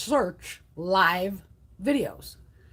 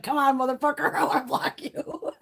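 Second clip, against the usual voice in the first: laughing mock taunt; 4 semitones higher than usual. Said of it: "She's like".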